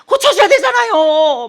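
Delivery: wife retelling her plea to God, a loud tearful yell; retold crying shout. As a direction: shout at God to fix him, as if retelling the tears. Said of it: "막 울면서 막 소리 지르고".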